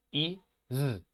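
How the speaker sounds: normal talking voice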